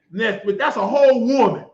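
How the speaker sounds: ordinary delivery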